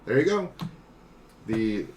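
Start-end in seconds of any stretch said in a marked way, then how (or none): 0.1-0.5 lightly voiced higher-pitched aside; casual acted quote. Game master voices player freeing a chocobo, casual higher voice. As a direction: toss it off, pitched up. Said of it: "you're just like".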